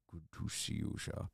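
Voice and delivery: hushed repeat of the greeting; whispered. Real, not acted.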